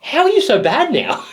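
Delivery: high, laughing incredulous retort; mock disbelief. Retelling an actor teasing Daniel Radcliffe, mock disbelief. Acted punchline of retold interview.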